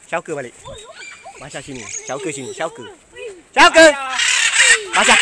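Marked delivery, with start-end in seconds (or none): 3.6-5.2 high, loud call to a bird. Real, not acted. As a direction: shout the name up to the sky. Calling the bird back.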